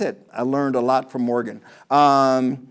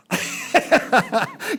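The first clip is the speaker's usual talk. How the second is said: laughing; 9 semitones higher than usual. Real, not acted. Smokey eyes joke.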